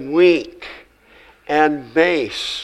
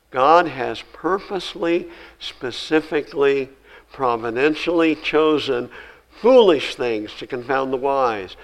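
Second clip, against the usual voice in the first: ordinary talk, nothing marked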